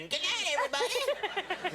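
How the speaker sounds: high, slow hammed-up sign-off; exaggerated high-pitched old-timer voice; decrepit old host. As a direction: call out a feeble farewell as an ancient entertainer. Imagines himself as a decrepit host.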